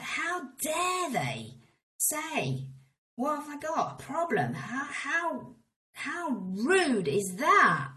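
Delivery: high, slow, outraged mock shout; fuming. Retelling her fury at the London office, high and slow. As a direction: fume at the nerve of it, drawn out.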